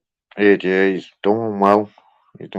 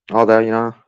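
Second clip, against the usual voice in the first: ordinary delivery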